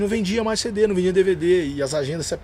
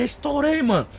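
mock triumph; 13 semitones higher than usual. Seeing eight thousand in cash.

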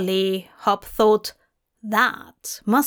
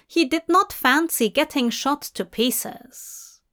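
ordinary delivery, nothing marked

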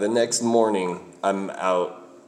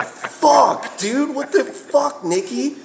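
much higher, louder mock outrage; outraged friends; 5 dB louder, much slower than usual. Friends in the back seat splashed with soda, high mock outraged yelp. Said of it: "the two people in the back seat are like".

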